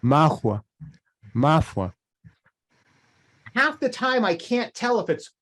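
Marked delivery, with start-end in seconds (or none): 0.0-1.9 "Mahua" said louder, blending h and f; his own mahua. Shown as a demonstration. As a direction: say "mahua" between hua and fua. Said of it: "listen to how, how I say this".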